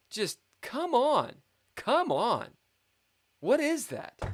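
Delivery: high-pitched exasperated outburst. Real, not acted. Player berating own chess blunder, high and exasperated. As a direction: plead with yourself in high disbelief.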